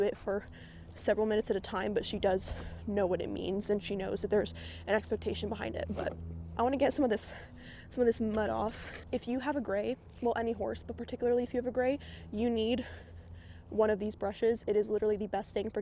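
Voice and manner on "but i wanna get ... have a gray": ordinary talk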